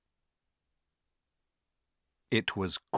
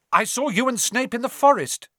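high, loud, urgent outburst; blurted out; 12 semitones higher, 6 dB louder than usual. A narrator's reading. Harry must keep Quirrell talking, blurted out. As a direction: burst out with it to distract him. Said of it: "he blurted out".

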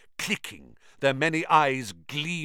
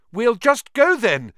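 high, loud desperate call; 14 semitones higher, 11 dB louder than usual. A narrator's reading. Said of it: "Harry called desperately".

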